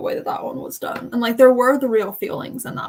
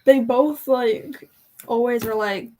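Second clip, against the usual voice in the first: usual speaking voice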